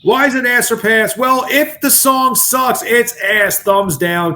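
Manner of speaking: high, slow show-host announcement; hammed-up announcer. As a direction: ham up the voting segment like a game-show host. Hosts the voting segment.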